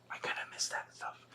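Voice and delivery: a real whisper, wistful and low-key; whispered aside. Real, not acted. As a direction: drop into a breathy confessional whisper. Wistful aside after the rant.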